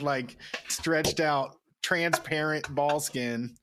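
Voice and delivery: ordinary talk, nothing marked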